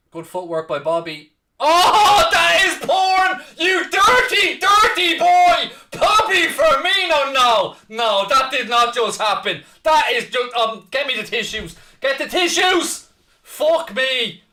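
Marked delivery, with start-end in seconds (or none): 1.6-7.3 screaming in shocked disbelief; high, loud stunned shout; shocked laughter. Real, not acted. Shocked at Firmino's goal. 7.3-14.4 loud stunned shouting; can't believe what he saw; shocked disbelief. Real, not acted. Disbelief after the goal.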